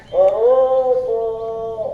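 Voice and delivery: long drawn-out street seller call; vendor's call. Retold or imitated. Preacher imitating a cracker seller calling out. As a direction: stretch it like a hawker calling door to door.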